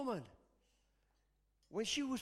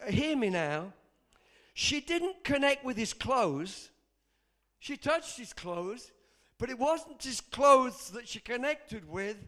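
slow, emphatic, rising preacher; full-force preaching; 5 semitones higher, 6 dB louder, slower than usual. Real, not acted. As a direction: hammer the point home to the congregation.

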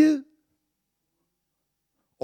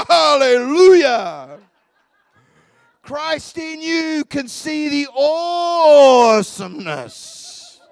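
exultant shout; 6 semitones higher, 9 dB louder, much slower than usual. Real, not acted. Preacher erupts in praise.

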